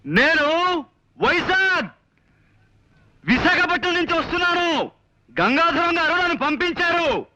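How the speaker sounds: high-pitched, drawn-out shout. Acted. Visitor repeats who sent him for a man who didn't hear, shouting. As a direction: call it out slowly for someone hard of hearing. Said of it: "అంత గట్టిగా అరుస్తారు ఎందుకు".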